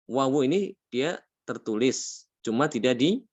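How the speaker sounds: ordinary talk, nothing marked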